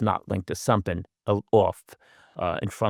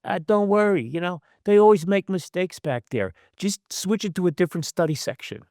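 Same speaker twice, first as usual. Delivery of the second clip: put-on Kenny's New York accent, pitched higher; 8 semitones higher than usual. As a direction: imitate an old New York neurologist's accent. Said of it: "in his New York accent".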